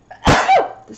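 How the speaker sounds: sudden loud, high sneeze; real sneeze. Real, not acted. Interrupting a craft video to sneeze, real loud sneeze. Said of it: "I have to sneeze".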